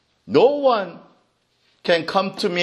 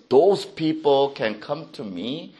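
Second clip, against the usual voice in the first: normal talking voice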